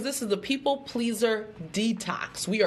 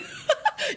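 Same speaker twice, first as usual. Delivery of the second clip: high squeal of a laugh. Real, not acted. Laughs at her little dance.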